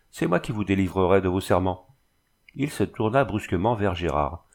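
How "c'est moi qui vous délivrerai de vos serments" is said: ordinary delivery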